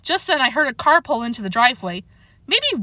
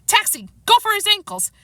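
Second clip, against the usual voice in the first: excited order; 10 semitones higher than usual. A narrator's reading. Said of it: "Chester shouted".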